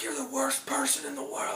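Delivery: high, soft strained mimicry of a furious man; squeaky falsetto rage, played for laughs; mock angry neighbor. Neighbor screaming at his girlfriend, high mock fury. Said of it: "he's like".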